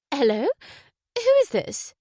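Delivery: a well-spoken woman on the intercom, caught off guard; lifted, surprised posh-lady voice. A narrator's reading. Wife on the intercom, surprised at a late caller. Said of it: "A well-spoken female voice ... sounding surprised".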